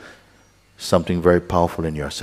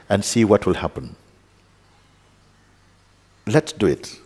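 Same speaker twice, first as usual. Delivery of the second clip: ordinary delivery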